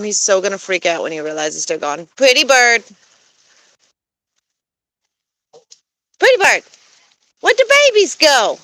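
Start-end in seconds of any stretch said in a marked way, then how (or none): 2.2-8.6 high coaxing voice to a bird; pet-talk voice. Real, not acted. Asking the goose Layla what's wrong, high coaxing. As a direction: coo at the goose, pitched up. Calling the gander.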